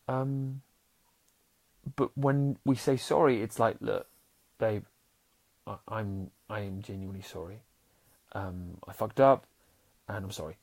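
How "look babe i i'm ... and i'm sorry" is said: mean every word, gently; sincere apology. Shown as a demonstration. Showing a real apology to his partner: soft sincere sorry. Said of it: "when we say it like that".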